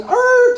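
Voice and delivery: high squealing tire screech sound. Retold or imitated. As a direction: mimic the tires squealing round the corner. Screeching tires while weaving.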